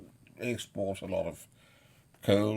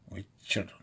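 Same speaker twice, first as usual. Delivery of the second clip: usual speaking voice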